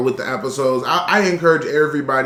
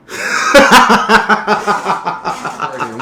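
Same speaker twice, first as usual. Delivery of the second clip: laughing; 5 semitones higher, 6 dB louder than usual. Real, not acted. Laughs after the retake.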